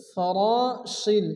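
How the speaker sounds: "ফরাশিল" slowly, the alif after র drawn; slow, careful "ফরাশিল"; madd demo. Shown as a demonstration. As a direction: stretch "রা" in "ফরাশিল". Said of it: "র আলিফ জবর র".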